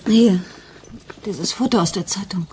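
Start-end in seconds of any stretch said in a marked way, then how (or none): 0.1-0.4 loud, high, urgent "Hier."; insistent here. Acted. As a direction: thrust the photo at her. Handing over the newspaper photo.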